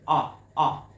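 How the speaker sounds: "ah" repeated loud and separately; two forceful, isolated "ah" vowels; short O sound. Shown as a demonstration. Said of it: "word that you hear the ah".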